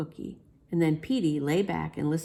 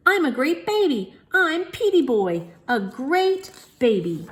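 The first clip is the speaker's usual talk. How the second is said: higher, louder, slow bragging; a higher boastful little kid voice; proud baby; 9 semitones higher, 6 dB louder, slower than usual. A narrator's reading. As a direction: crow it to everybody. Baby Petey bragging down the hall.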